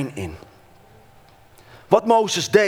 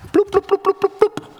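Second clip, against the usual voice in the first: squeaky high ploep ploep, like buckets popping into place; high popping noises; 3 dB louder than usual. Acted. Preacher mimics buckets landing on a lamp with squeaky pops. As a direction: pop each ploep out high and quick.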